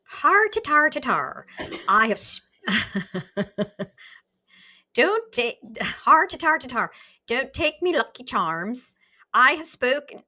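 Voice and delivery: a bad stage Irish accent from a cheerful old man. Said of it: "what kind of accent should John have?".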